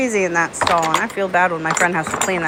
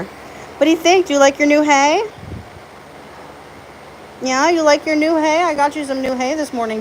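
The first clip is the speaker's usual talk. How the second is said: pet talk; 7 semitones higher than usual. Real, not acted. Owner asking her horses if they like the new hay, high coo.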